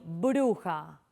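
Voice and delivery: "bruja" with the B pressed by both lips; bilabial B sound. Shown as a demonstration. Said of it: "el sonido".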